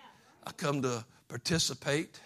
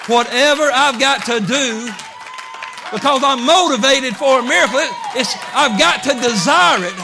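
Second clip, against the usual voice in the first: shouted, far higher preaching; preaching at full force; 8 semitones higher, 11 dB louder than usual. Acted. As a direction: build into a fervent shout. Preaching climax.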